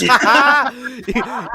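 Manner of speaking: loud high burst of laughter; a big real laugh. Real, not acted. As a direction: cackle at the joke. Laughing at own joke.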